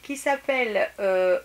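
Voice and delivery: ordinary delivery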